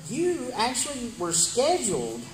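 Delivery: ordinary talk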